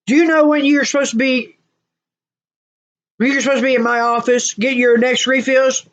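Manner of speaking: high, stern telling-off; doctor scolding. Retold or imitated. Doctor to a patient overdue for refills, scolding.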